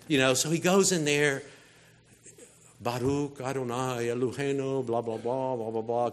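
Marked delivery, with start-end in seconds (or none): 2.8-6.1 low monotone chant of routine prayer; imitating a priest going through the motions; rote prayer. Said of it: "kind of doing the thing".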